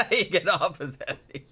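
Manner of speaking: real amused laughter; laughing. Real, not acted. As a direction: crack up while repeating the silly word. Cracking up at own made-up word.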